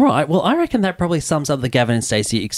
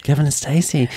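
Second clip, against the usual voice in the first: whispering; 4 semitones higher than usual. Acted. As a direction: whisper it back. Whispered echo.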